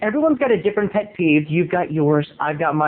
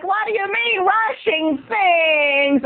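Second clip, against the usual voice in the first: high, very slow mock whine; imitating Laura; 12 semitones higher, much slower than usual. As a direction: wail it high and stretched. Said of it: "She's like".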